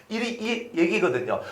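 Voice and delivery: ordinary talk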